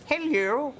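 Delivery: high, far-fetched posh "Hello" with a rising lilt; mock a posh woman saying "Hello". Mocking the posh caller's hello to his mate. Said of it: "Very posh".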